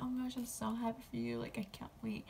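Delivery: imitating happy friend. Friend hearing pregnancy news, softly imitated delight. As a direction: lift the pitch high, gushing but kept soft. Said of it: "she was, like, happy".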